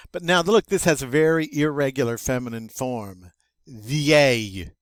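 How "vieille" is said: loud VIEILLE gliding into a Y; double L as Y. Shown as a demonstration. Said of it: "double L is pronounced like a Y".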